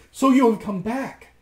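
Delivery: high, loud greeting; excited cry. A narrator's reading. Anne greets returning Rasmus, crying out high. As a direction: exclaim it in a high voice. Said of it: "cried Anne".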